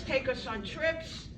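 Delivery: ordinary talk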